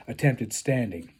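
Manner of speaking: ordinary delivery, nothing marked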